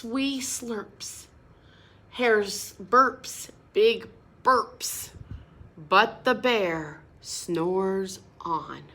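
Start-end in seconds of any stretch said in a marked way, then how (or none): none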